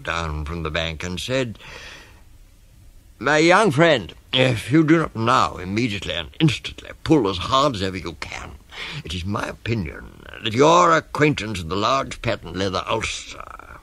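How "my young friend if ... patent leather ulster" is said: pompous, urgent warning, starting high and loud; python rock snake. Acted. Snake urges the elephant child to pull, pompous and emphatic. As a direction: declaim long words insistently. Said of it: "This is the way bicoloured python rock snakes always talk".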